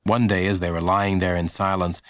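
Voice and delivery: ordinary delivery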